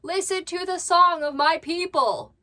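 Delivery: high, loud mock-proclamation as the cat; voicing the cat. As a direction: announce it grandly on the cat's behalf. Speaks for the yelling cat.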